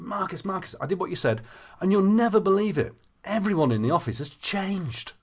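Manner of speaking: retold breathless-with-news excitement, pitched high; imitating excited client. Client rushing over to tell her coach the news, excited. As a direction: gush it like she just ran over. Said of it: "she came almost running over to me".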